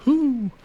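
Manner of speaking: high, loud "whoo" of relief; relieved whoop. Real, not acted. Startled by a cockroach, relieved high whoo. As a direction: let out a high whoop after a scare.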